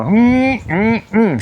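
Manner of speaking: high, drawn-out "mm, mm, mm"; admiring "mmm" noises; appreciative hum. Real, not acted. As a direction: hum with exaggerated relish, pitched well up. Admiring the woman he describes.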